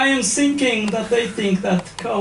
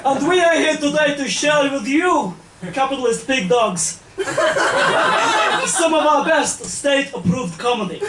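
put-on Russian accent. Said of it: "KGB comedy act".

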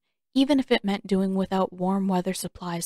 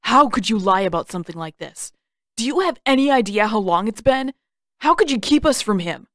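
furious accusation; 2 semitones higher, 5 dB louder, faster than usual. Acted. Sokka confronts Aang over the hidden map, furious and loud. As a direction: tear into him for hiding the map.

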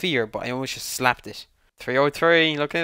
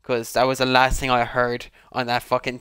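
ordinary talk, nothing marked

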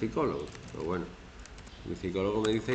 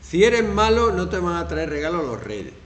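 threatening parent; 6 semitones higher, 8 dB louder than usual. Retold or imitated.

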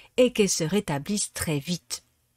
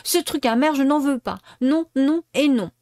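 higher, louder tearful refusal; sobbing shout; 5 semitones higher, 4 dB louder than usual. A narrator's reading. Pinocchio refusing the bitter medicine, tearful loud shout. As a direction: stamp out no, no and no through tears. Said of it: "cria Pinocchio qui éclata en sanglots".

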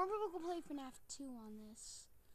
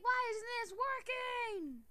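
imitating himself; 7 semitones higher, 7 dB louder, slower than usual. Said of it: "I was like".

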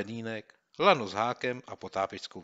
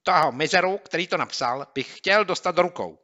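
much higher and louder, furious; angry boy; 8 semitones higher, 11 dB louder than usual. Acted. Boy angry at the prank writer, furious. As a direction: vent your anger.

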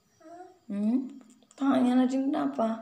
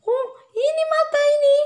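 put-on high, fast baby talk; high cooing baby talk; 8 dB louder, faster than usual. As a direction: squeak it like talking to a baby. Pointing at toddler's eyes.

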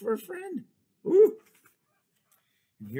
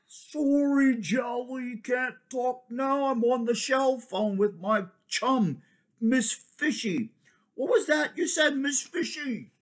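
high, louder, busy phone voice; distracted blowfish; 12 semitones higher, 5 dB louder than usual. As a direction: brush Jelly off while chatting.

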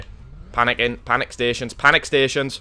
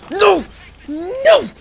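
very high, loud wail of no; high loud howl of defeat; despairing shout; 6 dB louder than usual. Real, not acted. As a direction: cry out as it all goes wrong. Dies in game.

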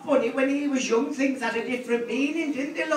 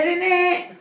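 put-on teen's innit; 9 semitones higher, 3 dB louder than usual. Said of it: "She says".

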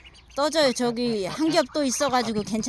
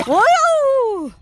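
long high startled exclamation; startled yelp as water splashes; surprised cry; 10 semitones higher, 8 dB louder, much slower than usual. Real, not acted. Ducklings splash, camera gets wet. (깜짝 놀란 외침)

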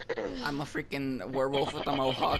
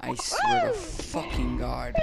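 exasperated; 13 semitones higher, 4 dB louder, slower than usual. Real, not acted. Frustrated after missing the hit.

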